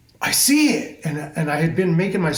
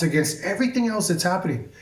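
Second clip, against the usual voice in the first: ordinary talk, nothing marked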